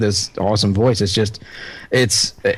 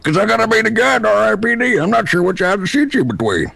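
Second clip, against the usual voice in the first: higher, rambling character voice; Jeff Bridges; 9 semitones higher than usual. Acted. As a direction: do a voice match of an actor in character. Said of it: "Jeff Bridges has gotta be".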